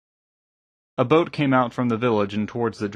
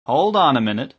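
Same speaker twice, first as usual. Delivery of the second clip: raised, louder interruption; firm objection, pitched up; correcting; 4 semitones higher, 6 dB louder than usual. A narrator's reading. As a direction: cut in to set them straight. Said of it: "Breek corrected him".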